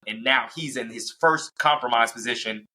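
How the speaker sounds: normal talking voice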